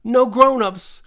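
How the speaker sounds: high, loud panicked cry; high creature voice almost shouting; fierce near shout. A narrator's reading. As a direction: snap it out to stop her. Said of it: "fiercely, almost shouting".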